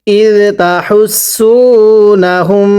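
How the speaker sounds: ইদ তাহুসুনাহুম drawn out very slowly, each syllable long; slow recitation. Shown as a demonstration. Teacher models the phrase for students, slow careful recitation. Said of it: "পড়ুন".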